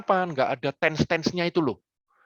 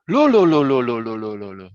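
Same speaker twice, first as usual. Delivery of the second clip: falling chain of lo; 5 semitones lower, 5 dB louder than usual. Shown as a demonstration. Coach showing how expressive Javanese "lo" can be, falling away. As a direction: let each "lo" fall lower and fade away. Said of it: "bahasa Jawa itu ekspresif".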